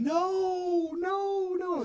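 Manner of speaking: high, drawn-out disbelief; mock anguished wail. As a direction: imitate people wailing at terrible news. Retold crew hearing take was lost.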